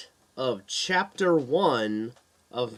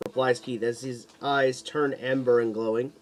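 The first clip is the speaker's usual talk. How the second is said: ordinary delivery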